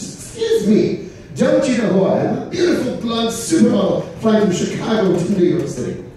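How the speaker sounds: high, haughty diva voice; snooty supermodel voice. Acted.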